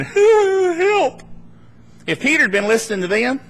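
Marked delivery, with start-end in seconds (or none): 0.0-1.2 high, drawn-out cry for help; mock scream. Disciples still in the boat, high mock scream for help. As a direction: imitate a panicked scream, pitched way up.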